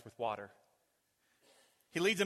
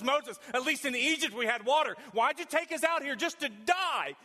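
complaining crowd; 11 semitones higher, 8 dB louder than usual. Acted. Hebrews complain to Moses.

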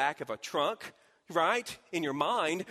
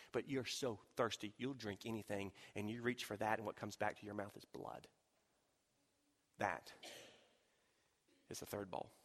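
low, soft, grave and drawn in; hushed warning; 6 semitones lower, 11 dB quieter than usual. Acted. Pastor warning the congregation softly about the bowl of blood. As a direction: lean in and darken the voice.

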